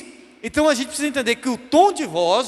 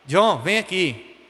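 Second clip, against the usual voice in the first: slow, even, unhurried call; calm mother; 3 semitones lower, much slower than usual. Retold or imitated. Mother calmly calling her son over, relaxed and slow. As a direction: call your child over with no threat at all. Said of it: "quando ela tava tranquila".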